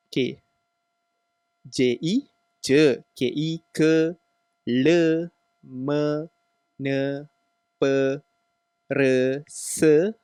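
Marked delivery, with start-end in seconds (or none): none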